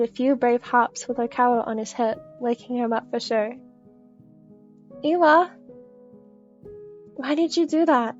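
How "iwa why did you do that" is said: high, loud startled yelp; startled outcry. A narrator's reading. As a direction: cry out, jolted awake. Jolted awake by hops.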